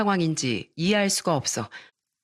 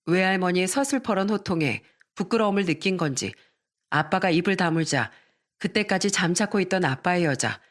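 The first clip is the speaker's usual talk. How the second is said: ordinary delivery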